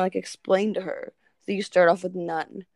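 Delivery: ordinary talk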